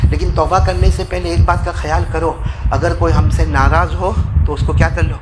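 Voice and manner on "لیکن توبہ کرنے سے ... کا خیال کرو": normal talking voice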